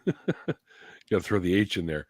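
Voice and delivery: ordinary talk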